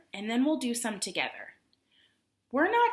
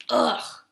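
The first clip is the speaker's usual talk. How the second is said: disgusted groan; 7 dB louder than usual. Acted. Teacher reads bear's groan.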